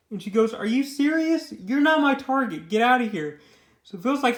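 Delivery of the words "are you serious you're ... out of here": higher, louder mock indignation; acted exasperation, pitched up; indignant brush-off. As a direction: retell her irritated dismissal. Acted brush-off in retold quarrel.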